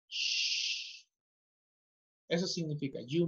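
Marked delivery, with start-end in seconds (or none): 0.1-1.0 soft unvoiced shush for silence. Shown as a demonstration. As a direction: hush the class with a long shh. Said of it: "Debes estar en silencio".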